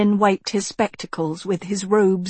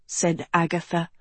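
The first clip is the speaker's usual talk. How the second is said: ordinary delivery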